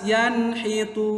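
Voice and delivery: "Yanhitun" chanted high and slow, clear n; correct recitation. Shown as a demonstration. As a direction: recite "Yanhitun" with a drawn-out "tun". Teacher recites correct example. ("Yanhitun" dilantunkan pelan, nun jelas)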